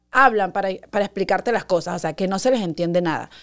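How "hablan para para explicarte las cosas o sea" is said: ordinary delivery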